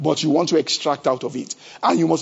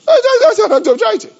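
laughing exclamation; 5 dB louder than usual. Real, not acted.

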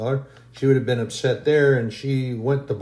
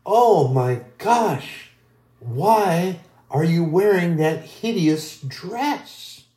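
slow, drawn-out gasp of mock horror, pitched higher; shocked gossiping friend; 5 semitones higher, much slower than usual. Friend at dinner gasping over the wife's dress, slow and pitched up.

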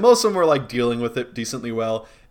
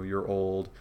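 ordinary talk

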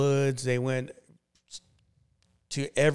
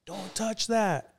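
much higher, alarmed and urgent; imitating his girlfriend's alarm, pitched up; freaking out; 9 semitones higher than usual. Girlfriend panicking over the gun in his hand, high and alarmed. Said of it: "like, freaking out".

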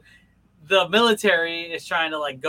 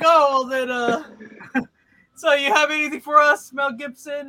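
higher, louder, laughing question; a lightly voiced eager officer; 11 semitones higher, 8 dB louder than usual. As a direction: ask politely through a laugh. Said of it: "he's like".

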